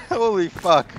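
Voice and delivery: laughing. Real, not acted. Laughing amazement.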